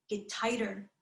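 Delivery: normal talking voice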